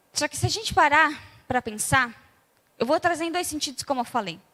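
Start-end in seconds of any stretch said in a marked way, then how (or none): none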